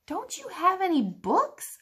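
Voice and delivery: put-on high, hopeful boy's question; boy asking pirates for a bedtime book; child asking. A narrator's reading. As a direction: ask it like a sleepy boy. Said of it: "I asked".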